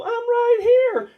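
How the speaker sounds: high, slow, tiny voice; a drawn-out little voice from below the table; small voice from the floor. Retold or imitated. Hungover patient calls up from the floor, high and slow. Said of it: "I hear this voice go".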